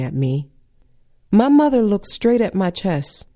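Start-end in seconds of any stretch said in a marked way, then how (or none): none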